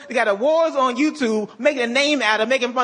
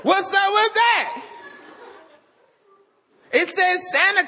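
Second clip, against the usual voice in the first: mock anti-Santa blogger; 9 semitones higher than usual. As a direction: rage at Santa like an obsessed blogger. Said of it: "It's like me having a Santa Claus blog".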